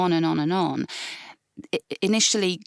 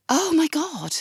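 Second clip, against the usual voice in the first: sharp gasp, then a high "oh my God"; shocked gasp; 8 semitones higher, slower than usual. Retold or imitated. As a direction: relive the jolt of hearing her name. Recalling shock at hearing sister's name.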